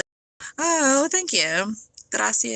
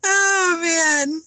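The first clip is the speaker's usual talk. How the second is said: high, louder laughing groan; loud amused exclaim; 9 semitones higher, 7 dB louder than usual. Real, not acted. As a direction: react big to a funny comment. Laughing reaction to comment.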